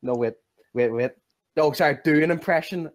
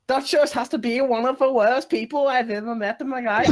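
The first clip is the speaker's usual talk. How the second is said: hammed-up Dutch accent, pitched up; 8 semitones higher than usual. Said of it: "Dutch accent you've ever heard".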